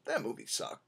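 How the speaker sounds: soft, low, casual verdict; mild deadpan shrug, no big deal; offhand shrug. Retold or imitated. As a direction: toss it off with a shrug. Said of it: "I just kinda walked out and I go".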